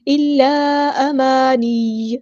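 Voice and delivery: slow, drawn-out Quranic recitation; Tajweed recitation. Shown as a demonstration. Teacher models the verse word, slow Tajweed recitation. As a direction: recite slowly, holding each long vowel. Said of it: "four five count لمبا کرنا ہے".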